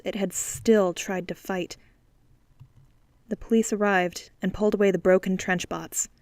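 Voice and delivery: plain reading voice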